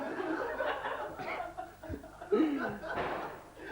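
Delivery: unvoiced wheezing laugh, trailing down; comic wheezing at his own rude gag; wheezy laugh. Real, not acted. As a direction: laugh silently, fading. Teller laughs at own joke.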